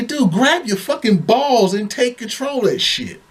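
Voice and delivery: high, slow, emphatic plea; exasperated urging. Retold or imitated. Man telling the meek husband to take control, exasperated.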